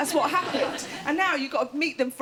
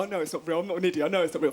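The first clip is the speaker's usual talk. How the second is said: dismissive retort; 8 semitones lower, much faster than usual. Acted. Comedian answering astrology doubters, mock dismissive. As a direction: brush them off, pitched well down.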